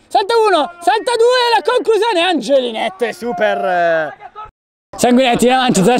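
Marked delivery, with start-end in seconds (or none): 0.1-2.2 excited. Real, not acted. Dribbling run toward goal.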